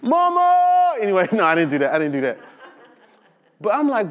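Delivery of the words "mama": high, loud comic wail; mock cry for mother. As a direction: call out for mama like a scared child. Jokes he cried for mother.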